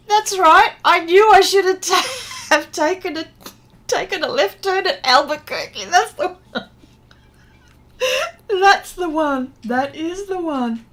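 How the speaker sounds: high, loud, laughing through the words; real delighted laughter. Real, not acted. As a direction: crack up while recalling a favourite catchphrase. Recalls cartoon catchphrase, cracking up.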